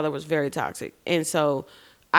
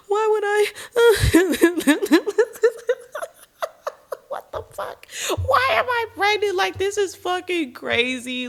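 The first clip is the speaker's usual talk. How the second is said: much higher, swinging between wild laughter and sobbing; hysterical laugh-cry; 13 semitones higher, 3 dB louder than usual. Retold or imitated. Seeing the positive test, she laughs and cries hysterically. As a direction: lose it, laughing and crying at once. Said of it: "hysterically laughing to hysterically crying".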